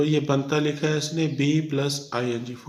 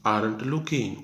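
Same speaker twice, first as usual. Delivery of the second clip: normal talking voice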